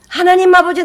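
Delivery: hammed-up desperate prayer; mock fervent cry. Preacher mimicking a zealous prayer, loud mock cry. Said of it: "이렇게 기도 못 합니다".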